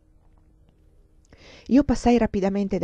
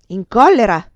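loud, incredulous outburst; furious yell; 2 semitones higher, 11 dB louder than usual. A narrator's reading. Enraged lover throwing the countess's question back, furious yell. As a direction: explode at the question. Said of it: "urlò lui".

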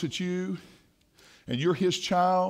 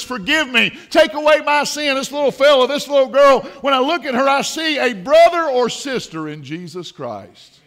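loud, higher preaching voice; impassioned preaching; 5 semitones higher, 7 dB louder than usual. Real, not acted. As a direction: preach it out with fervour.

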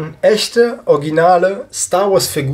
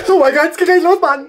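much higher and louder, squealing "Oh mein Gott"; excited squeal; 3 dB louder than usual. Real, not acted. Fan waits for his booster pack opening, squealing with excitement. Stream about to start, overexcited.

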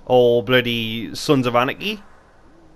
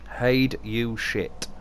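drawn out very slowly, weird tone; slow odd voice; 3 semitones lower, 6 dB quieter, much slower than usual. Real, not acted. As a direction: stretch "Hide you shit" out in a strange slow drawl. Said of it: "why do I sound like that?".

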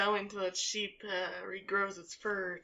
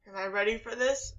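normal talking voice

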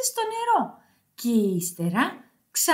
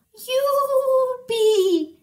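high, drawn-out goat whoop; bleated cheer; 8 semitones higher, 3 dB louder than usual. Acted. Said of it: "βέλασε η κατσίκα". (χαρούμενο βέλασμα κατσίκας)

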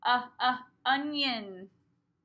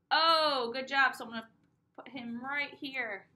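ordinary delivery

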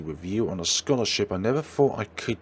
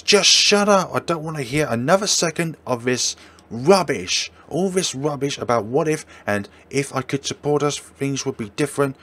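furious yell; 5 semitones higher, 6 dB louder than usual. Acted. Girlfriend screaming at boyfriend in a fight, furious. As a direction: scream it, then keep raging high and loud. Quarrel, she screams at him.

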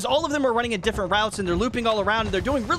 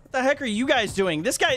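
usual speaking voice

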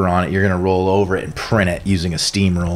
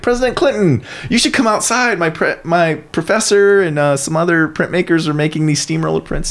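high-pitched, happy student voice; bubbly student; 7 semitones higher than usual. Retold or imitated. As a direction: imitate an eager student calling someone over. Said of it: "very happy all the time".

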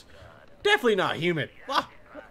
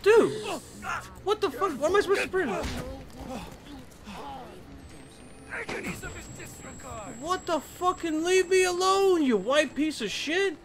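panicked yelling; 10 semitones higher than usual. Real, not acted. Gamer chased by an enemy, yelling high in panic. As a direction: scream it out in panic.

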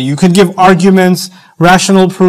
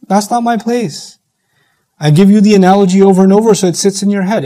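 ordinary talk, nothing marked

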